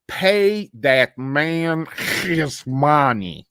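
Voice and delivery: put-on slow, thick Russian mobster accent; Teddy KGB. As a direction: draw it out like a Russian mafioso. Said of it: "Teddy K G B impression".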